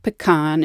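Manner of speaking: "pecan" as pih-KAHN, stress on the second syllable; Louisiana pronunciation. Shown as a demonstration. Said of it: "we have some regional tendencies".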